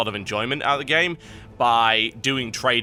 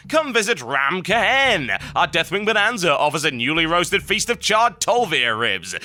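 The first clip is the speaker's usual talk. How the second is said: high, punchy commercial voice, slogans stretched out; hammed-up advert; 5 semitones higher than usual. Host reading a joke Ram Kahen postcard, hammed-up advert pitch. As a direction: sell it like a TV holiday promo. Reads a mock resort advert.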